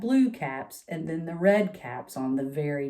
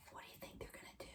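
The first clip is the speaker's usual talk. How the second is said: whisper; 30 dB quieter, much faster than usual. Real, not acted. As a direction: lean in and whisper the question. Asks children in a hushed whisper.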